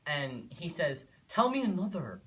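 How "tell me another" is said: loud, high scoff of disbelief; scoffing schoolboy. Acted. Schoolboy disbelieving the boy's claim, loud mocking scoff. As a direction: jeer at an obvious tall tale, voice raised.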